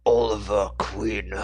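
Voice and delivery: slow, gravelly-free menacing villain growl of a name; Deathstroke voice. Shown as a demonstration. Host demos the villain voice for listeners, slow menace. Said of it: "he kinda talks like".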